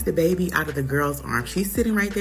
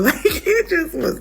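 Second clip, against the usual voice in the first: high, slow words broken by laughter; laughing in disbelief; 12 semitones higher, 4 dB louder, slower than usual. Real, not acted. Telling friends about the awkward visit, laughing in disbelief. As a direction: laugh through it, can't believe it.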